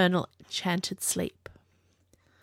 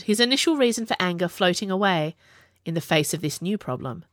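ordinary delivery

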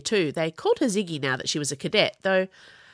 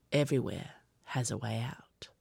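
lower, softer, unvoiced whisper; fading whisper; 6 semitones lower, 7 dB quieter than usual. A narrator's reading. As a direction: say it to yourself, almost gone. Repeats to himself, fading.

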